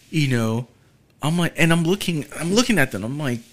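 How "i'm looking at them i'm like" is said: usual speaking voice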